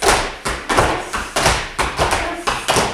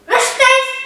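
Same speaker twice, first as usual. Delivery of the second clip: shouting drill sergeant; 6 semitones higher, 4 dB louder, faster than usual. Real, not acted. Teacher drilling children in formation.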